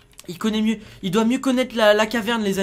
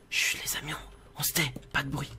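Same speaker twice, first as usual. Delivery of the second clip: sneaking half-whisper, lower and softer; hushed; 6 semitones lower, 7 dB quieter than usual. Real, not acted. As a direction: keep it down so nobody hears. Sneaking, demands silence. (à voix basse, presque chuchoté)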